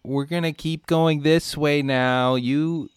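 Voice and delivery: loud, high call to the lemur. Acted. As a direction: shout a goodbye into the trees. Calling goodbye to Jeff.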